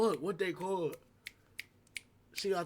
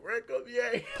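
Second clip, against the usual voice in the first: mock taunting chant, pitched up; trolling; 4 semitones higher, slower than usual. Cousin trolling the angry girl, teasing. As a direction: needle her with a teasing lilt.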